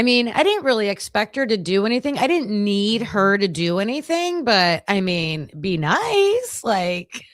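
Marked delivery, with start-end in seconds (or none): 5.6-6.6 much higher sing-song "be nice"; pleading squeak. Retold or imitated. Wishing the rude stranger had been kind, pitched high. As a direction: squeak it like a plea.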